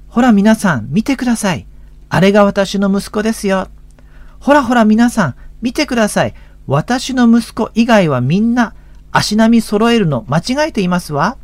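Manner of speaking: high-pitched doting mother hailing the crowd; proud mother calling out. Acted. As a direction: call everyone to look, hammed-up and high. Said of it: "一人のお母さんが大きな声で言いました".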